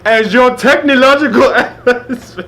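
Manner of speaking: loud, booming mock African accent. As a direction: shout it grandly in African accent. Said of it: "They done jumped up".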